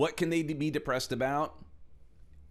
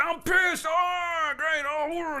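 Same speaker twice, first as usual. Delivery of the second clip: much higher, loud raging yell; hammed-up road rage outburst; furious shout; 4 dB louder, much slower than usual. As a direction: explode at the whole world. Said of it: "They'll say it".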